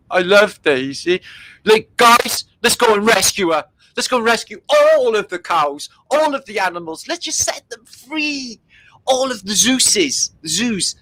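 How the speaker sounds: high, excited call to free the animals; rally the guys to rescue the cows; mock rallying cry. Host rallying viewers to free the animals, high and excited.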